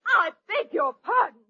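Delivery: usual speaking voice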